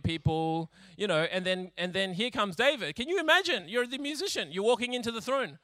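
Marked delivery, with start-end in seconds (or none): none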